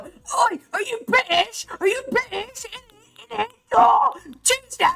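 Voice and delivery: high, loud, hammed-up British accent; loud Americans mocking a Brit in a shrill mock British accent. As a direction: squeal it like a cartoon Cockney Brit. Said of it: "And then they go".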